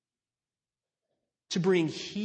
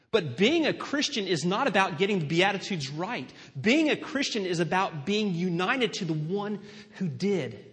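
louder, driving sermon delivery; preaching at full force; 3 semitones higher, 6 dB louder than usual. Acted. Pastor pressing the point on his church, louder. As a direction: proclaim it to the whole room.

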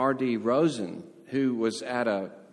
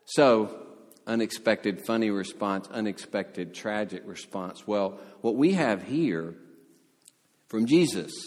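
ordinary talk

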